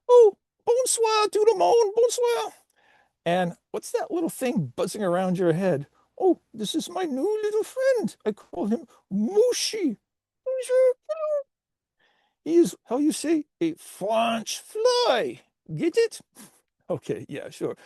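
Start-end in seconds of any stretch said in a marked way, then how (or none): 0.1-2.5 very high, effusive French squeal; terrible French accent. Acted. Potato salad greeting storyteller friends, squeaky French accent. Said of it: "you have a terrible French accent". 6.2-16.2 squeaky, high French cartoon voice; terrible French accent. Potato salad introducing its fly friend, squeaky French accent. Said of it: "you have a terrible French accent".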